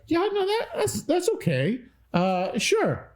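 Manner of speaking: very high, strained polite "yeah, no"; polite Midwest self. Retold or imitated. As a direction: force out a polite okay you don't mean. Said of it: "And I was like".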